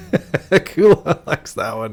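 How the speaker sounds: slow, laughing repeat. Real, not acted. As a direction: drag it out through a laugh.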